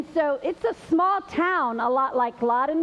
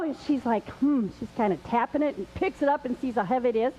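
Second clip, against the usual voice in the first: plain reading voice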